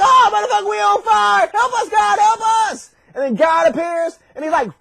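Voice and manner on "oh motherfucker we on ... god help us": high, hammed-up panic; panicked yelling. Friends in the fire begging God for help, mock panic. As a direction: yell frantically for rescue. Men thrown in fire plead.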